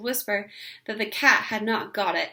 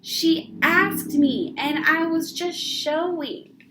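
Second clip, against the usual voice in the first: higher, louder, very slow; protesting whine; 7 semitones higher, 5 dB louder, much slower than usual. A narrator's reading. Ramona protesting while dragged to car, slow and high. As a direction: drag it out as a plea. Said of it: "protested Ramona".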